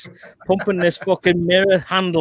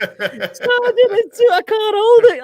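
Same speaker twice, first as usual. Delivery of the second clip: much higher, strained recruit's whine; whining recruit; 5 dB louder, much slower than usual. Retold or imitated. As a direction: squeal like a recruit crushed by a gun barrel. Recruit straining under the barrel.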